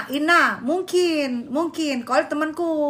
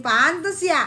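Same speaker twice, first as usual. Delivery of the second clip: ordinary talk, nothing marked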